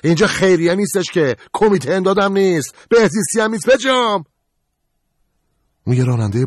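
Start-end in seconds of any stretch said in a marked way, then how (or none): 0.0-4.2 angry yell. Acted. Qorban yelling at narrator.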